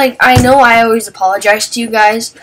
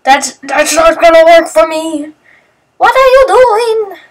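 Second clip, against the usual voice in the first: exasperated; 6 semitones higher, 4 dB louder than usual. Retold or imitated. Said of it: "And Matthew's like".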